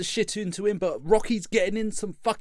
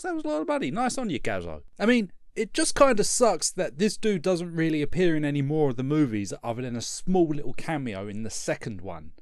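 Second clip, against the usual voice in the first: ordinary delivery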